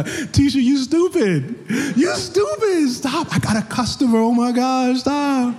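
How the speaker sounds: fast food worker goofing off with a coworker, high and giggly. Retold or imitated. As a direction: tease your coworker while a customer waits.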